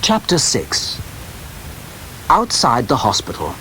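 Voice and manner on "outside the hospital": ordinary delivery, nothing marked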